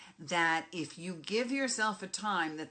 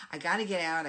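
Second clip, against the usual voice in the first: I gotta get out, blurted much louder; antsy outburst; 8 dB louder, much faster than usual. Retold or imitated. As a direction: push "gotta" out loud like you're bursting to leave. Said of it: "getting very antsy".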